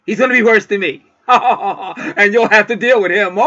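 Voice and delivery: high, loud, laughing through the warning; laughing tease. Real, not acted. Laughing as she warns the listener about her partner. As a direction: chuckle it out like a playful warning.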